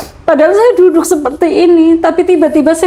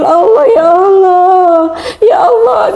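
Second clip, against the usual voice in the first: crying out to God; 6 semitones higher, slower than usual. Retold or imitated. Collapsed on the floor, crying out to Allah. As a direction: wail God's name through tears. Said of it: "Dan saya menangis, saya panggil-panggil".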